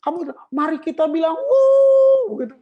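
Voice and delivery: high, hammed-up hype-man voice with a long yelled "Wuh"; mocking a hyped-up worship leader. Preacher mocking worship leaders, hammed-up high hype voice. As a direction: lampoon a pushy leader whipping up the crowd. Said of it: "Jadi orang teriak-teriak".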